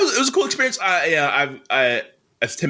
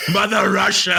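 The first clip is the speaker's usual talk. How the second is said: a laughing, hammed-up Russian accent shouted out; comic shouted Russian accent; 5 semitones higher, 4 dB louder than usual. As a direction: bellow "Mother Russia" in a jokey Russian accent. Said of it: "give them your famously good Russian accent".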